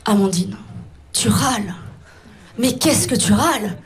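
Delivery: low, measured talking-to with long pauses; solemn self-reproach. Acted. Comedian facing her mirror, solemn mock self-reproach. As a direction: confront yourself, low and deliberate. Mirror introspection routine, addressing herself.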